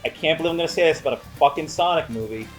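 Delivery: usual speaking voice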